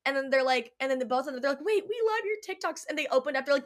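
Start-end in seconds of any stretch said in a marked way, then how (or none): 1.7-2.8 excited gush. Retold or imitated. Cast members gush.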